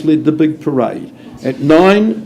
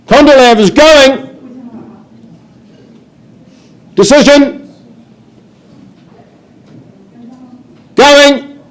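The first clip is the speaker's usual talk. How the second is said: louder, higher final calls; rising auction calls; 6 semitones higher, 4 dB louder than usual. Real, not acted. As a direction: build to the hammer.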